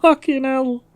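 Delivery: high-pitched, shocked swearing; startled. Real, not acted. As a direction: swear in a high voice straight after a scream. Just screamed from a fright.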